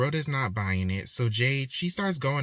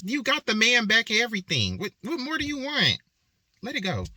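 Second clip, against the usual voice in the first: high-pitched, emphatic exasperation; exasperated; 9 semitones higher than usual. Pretend. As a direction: plead in disbelief, pitch leaping way up. Exasperated plea to a bitter character.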